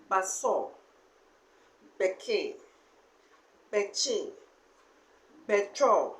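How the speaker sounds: baso, beke, beche, betro, each with its last vowel stressed; a word list with stressed endings; final-vowel stress. Shown as a demonstration. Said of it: "stressing on final sounds".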